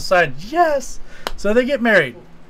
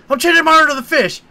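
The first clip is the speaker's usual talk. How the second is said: high, fast and loud, backing out in a hurry; mock-panicked quick change of order; panicked rush; 10 semitones higher, 7 dB louder, faster than usual. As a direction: blurt it out fast, like a nervous waitress. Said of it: "she's like".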